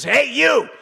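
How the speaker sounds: high imitation, calling out gruffly; crotchety old man. Bernie calls out to the waitress, crotchety old man voice. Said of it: "kinda crotchety old man".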